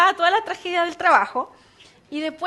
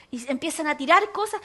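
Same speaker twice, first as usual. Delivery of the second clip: ordinary delivery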